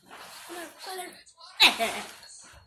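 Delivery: high burst of laughter; loud laugh. Real, not acted. Laughs after bit.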